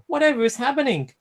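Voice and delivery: high, fast flustered squeal; startled trucks. A narrator's reading. Silly trucks startled by the sudden pull, high fast squeal.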